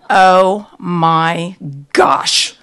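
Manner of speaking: loud, drawn-out exclamation; exclaiming. A narrator's reading. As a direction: exclaim in shock, stretching each word. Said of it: "I exclaimed".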